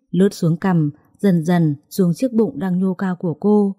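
usual speaking voice

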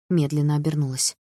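ordinary delivery, nothing marked

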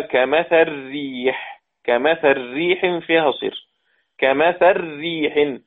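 كمثل ريح slow, the L of كمثل merged into the R, "kamatharrih"; teacher drilling the L merged into the R; merging l into r. Shown as a demonstration. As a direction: slowly recite كمثل ريح with the L swallowed into the R. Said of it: "اللام مكسورة".

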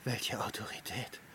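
soft breathy question; shaken whisper. Acted. Antonius asks in a frightened whisper. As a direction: whisper it, unsettled.

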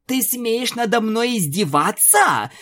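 high, fierce cry; on the edge of a breakdown, yell it; furious shout. Acted. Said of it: "свирепо крикнула Луна". (свирепо крича)

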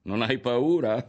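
loud, high jeer; scoffing, derisive echo; mocking laugh. A narrator's reading. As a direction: throw it back at him in mockery. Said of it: "rise Berto".

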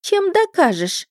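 put-on high, slow girlish challenge; doubting mermaid. A narrator's reading. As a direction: stretch it out, demanding proof. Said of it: "с сомнением протянула русалка". (недоверчивая юная русалка)

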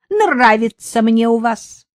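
a lightly voiced merry blurt, slow; cheerful blurt. Homeless woman cheerfully answering the sergeant, merry blurt. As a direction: blurt it out happily. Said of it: "весело выпалила бомжиха".

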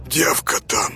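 husky whispered voice; hoarse voice. Acted. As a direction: rasp it out in shock. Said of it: "сиплым голосом".

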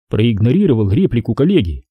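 ordinary delivery

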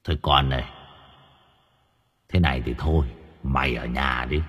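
Bột's voice. Pretend. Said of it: "Bột bảo".

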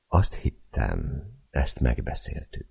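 slow, soft, held-back voice; as soft as possible. Acted. Husband to wife over the tree, forcing a soft slow voice. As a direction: keep it gentle and slow despite the anger. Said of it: "Olyan lágyra veszem a hangomat".

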